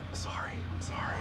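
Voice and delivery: barely voiced, sheepish sorry; whispered apology. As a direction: whisper it secretly to the driver. Silent apology to the Uber driver.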